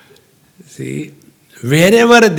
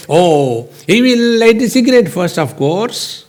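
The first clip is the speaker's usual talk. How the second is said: higher, quick mock-certain answer; confident assumption; 6 semitones higher than usual. Preacher voicing the audience's assumed answer, mock-certain. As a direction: say it as the obvious answer, pitched up.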